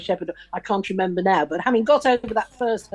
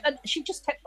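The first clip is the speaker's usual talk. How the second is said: ordinary delivery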